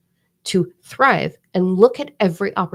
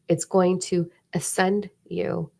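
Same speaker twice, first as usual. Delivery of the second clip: ordinary talk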